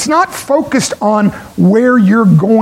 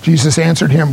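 ordinary delivery

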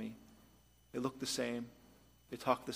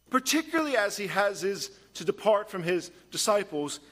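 raised, driving preacher's voice; full-force preaching; 5 semitones higher, 3 dB louder than usual. Acted. Pastor warning the church about worldly wisdom, fervent preaching. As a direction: preach it hard to the congregation.

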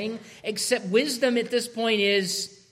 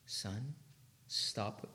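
whisper; 10 semitones lower, 16 dB quieter than usual. Acted. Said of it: "There's a time and place for that".

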